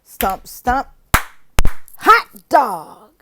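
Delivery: chanted stomp stomp, loud on hot dog; classroom cheer demo. Shown as a demonstration. Teacher demonstrating a class cheer, loud and chanted. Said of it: "So that's the stomp".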